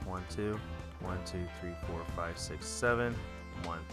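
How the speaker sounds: ordinary talk, nothing marked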